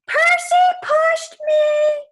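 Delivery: high, loud, drawn-out shriek; screaming accusation. A narrator's reading. Nancy in the fountain screaming at Percy, shrieking. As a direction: wail it like a tattling bully.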